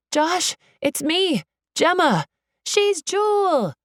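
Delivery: high, drawn-out calls; urgent, carrying shout. A narrator's reading. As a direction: call slowly and high across the crowd. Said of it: "the teens shout".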